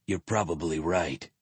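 low, soft, glum agreement. A narrator's reading. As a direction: concede it with understated gloom. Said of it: "Kirik agreed glumly".

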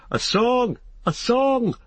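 high, repeated crowd call; shouted clamour. A narrator's reading. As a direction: chant the demand like a rowdy audience. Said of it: "shouted all the others".

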